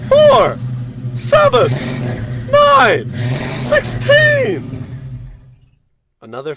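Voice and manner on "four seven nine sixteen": very high, loud robotic number barks; robot blaring random numbers at the captain. Acted. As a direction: shout each number like a malfunctioning calculator. Robot blurts survivor numbers.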